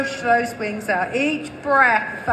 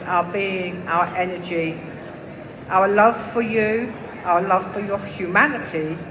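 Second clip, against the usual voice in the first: channeled message; 6 semitones lower than usual. Acted. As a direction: speak as the dragons' channel, gravely.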